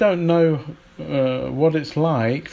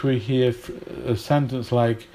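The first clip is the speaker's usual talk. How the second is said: usual speaking voice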